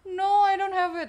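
high, loud cry of frustration; frustrated outburst. Real, not acted. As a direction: wail it out loud on a long "No". Fails to find the playlist.

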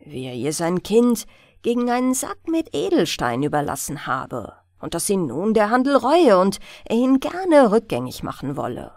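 ordinary delivery

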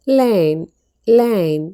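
ലൈൻ said as "line" with a long ai, twice and clearly; pronunciation demo. Shown as a demonstration. Teacher shows students how to read ലൈൻ, clearly. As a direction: model ലൈൻ with a clean long ai. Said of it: "ഇതെങ്ങനെയാണ് വായിക്കുക".

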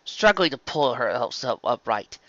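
ordinary delivery, nothing marked

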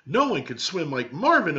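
put-on high, bold child's declaration; declaring girl. Elaine tells the family about Marvin, high and proud. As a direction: announce it like a proud little cousin. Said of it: "Elaine declared".

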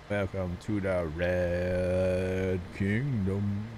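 slow announcer voice. Pretend. Drawn-out mock welcome announcement.

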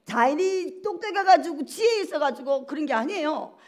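normal talking voice

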